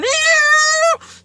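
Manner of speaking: high, squealing mock noise; squeaky compass noise. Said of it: "It's like a compass going".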